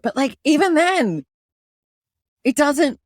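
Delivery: exasperated, high-pitched rant; really bothered. Real, not acted. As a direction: vent it, pitch riding high. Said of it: "it's really been bothering me lately".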